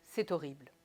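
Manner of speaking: low, even "horrible" with a falling end; restrained delivery, no punch on "horrible"; understated intonation. Shown as a demonstration. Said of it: "dites différemment".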